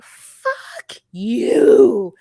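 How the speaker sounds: loud angry fling at the screen; fuck you retort. Retold or imitated. Podcaster reacting to Statler, loud angry retort. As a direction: spit it out in outrage.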